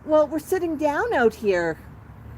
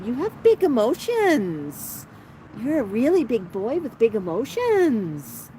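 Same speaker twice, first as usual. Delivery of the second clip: ordinary talk, nothing marked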